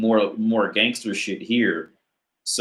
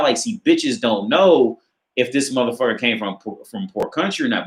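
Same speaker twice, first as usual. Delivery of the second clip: ordinary talk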